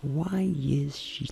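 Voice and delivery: slow, soft practice murmur; intimate voice. Shown as a demonstration. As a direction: stretch it out quietly. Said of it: "Voz íntima é uma". (voz íntima, devagar e baixinho)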